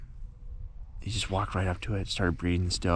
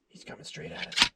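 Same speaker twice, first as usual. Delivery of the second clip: excited hushed whisper; 8 semitones higher, 5 dB quieter than usual. Real, not acted. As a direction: whisper fast with rising excitement. Deer approaching the hunters.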